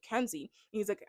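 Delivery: ordinary delivery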